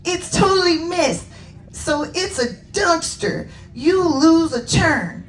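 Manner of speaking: high, insistent cartoon youngster; Peachy Pink shouting back. Pink unicorn overrules Babsy in hornball, high insistent voice. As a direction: rule it out emphatically in a high voice. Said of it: "a rose-colored unicorn shout back".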